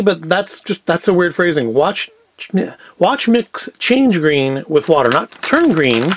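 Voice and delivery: ordinary talk, nothing marked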